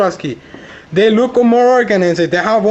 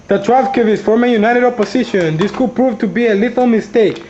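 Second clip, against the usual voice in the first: normal talking voice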